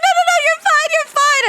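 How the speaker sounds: high, loud squeaky reassurance. Retold or imitated. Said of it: "she goes, she's like".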